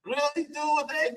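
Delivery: high-pitched retold disbelief; incredulous. Teammates react to the faker's recovery, incredulous.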